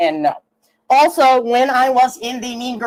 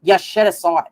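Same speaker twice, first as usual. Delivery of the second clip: mom voice; 9 semitones lower, 3 dB quieter, faster than usual. Retold or imitated. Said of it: "then she's like".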